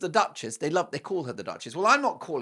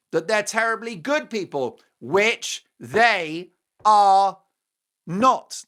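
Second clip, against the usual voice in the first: slow, loud drawn-out which they are not; mocking emphasis on not; emphatic not; 5 semitones higher, 9 dB louder, much slower than usual. Real, not acted. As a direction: stretch it out and land hard on not. Sarcastic buildup about Harry and Meghan.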